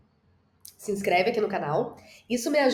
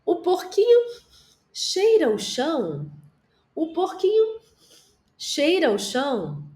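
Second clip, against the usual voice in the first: "porquinho" squeaked much higher, then "cheira o chão" drawn out; playful dramatization; 7 semitones higher, slower than usual. Shown as a demonstration. Teacher dramatizing the piglet for young children, squeaky and playful. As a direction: act out the little pig for small kids. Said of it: "ama fazer uma dramatização".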